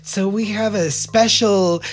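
high, showy emcee voice; drag queen host. Retold or imitated. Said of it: "the drag queen that was there was like".

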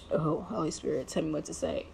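soft, murmured plea to the Spirit; hushed prayer aside. Real, not acted. Praying to herself mid-vlog, asking for words, hushed. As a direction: drop to a private whisper-prayer.

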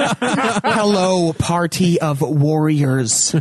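put-on slow, drawn-out accented film-mentor voice; hammed-up impression, stretching out each word; Raiden. Mocking the actor's voice for the co-hosts, slow hammy impression. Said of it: "trying to do a Werner Herzog impersonation".

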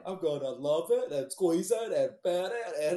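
slow, dopey cartoon voice; cartoon character impression. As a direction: drag it out like an old cartoon character. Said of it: "old cartoon where it's like".

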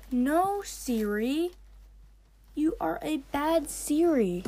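high, loud telling-off; exaggerated, drawn-out mock reprimand; mock scolding. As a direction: scold it like a naughty pet. Scolds Siri for interrupting the recording.

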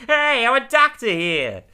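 high, slow mobster put-on voice; Louie objecting. Louie protests being called Doctor Jerkoff, high mock voice. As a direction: protest in a silly high gangster voice. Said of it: "And Louie's like".

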